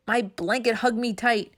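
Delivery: ordinary delivery, nothing marked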